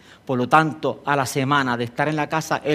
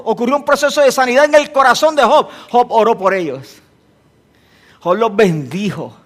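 ordinary delivery

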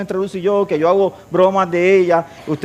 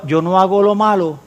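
ordinary talk

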